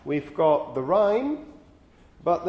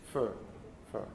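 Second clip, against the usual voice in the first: "fur" in Southern Standard British, no final r; non-rhotic RP "fur" with a long central vowel; RP accent; 7 semitones lower, 11 dB quieter than usual. Shown as a demonstration. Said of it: "the way to do it in R P or in Southern Standard British English".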